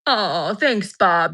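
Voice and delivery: ordinary delivery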